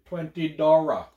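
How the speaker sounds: drawn-out "Twenty Dara", L turned to R; put-on accent. Shown as a demonstration. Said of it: "trying to do an accent".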